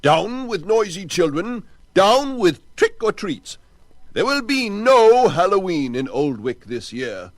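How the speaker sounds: high, loud ranting shout; angry man shouting down the holiday. Acted. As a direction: bellow it at the crowd. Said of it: "shouted Butterbean".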